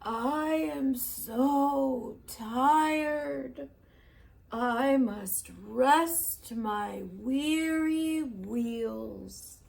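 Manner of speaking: put-on very slow, weary old engine voice; sighing, slow and tired. A narrator's reading. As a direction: drag every word like worn-out wheels. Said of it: "the rusty old engine sighed".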